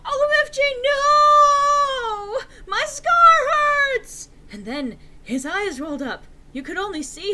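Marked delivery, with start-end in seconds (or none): 0.0-4.3 very high, loud crying scream; screaming in pain. Acted. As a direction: shriek in agony over the scar. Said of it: "he started to scream".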